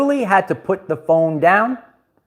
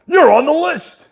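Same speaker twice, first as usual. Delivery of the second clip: [name]; 12 semitones higher than usual. Retold or imitated. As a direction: bark it like a heel wrestler pointing you out. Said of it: "that's what [name] does".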